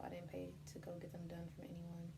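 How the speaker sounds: breathy whispered aside; whispering. Real, not acted. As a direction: whisper it as a secret. Aside about own hair.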